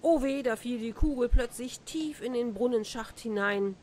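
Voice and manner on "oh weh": ordinary delivery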